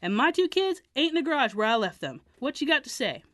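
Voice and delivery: put-on father yelling at kids; angry father.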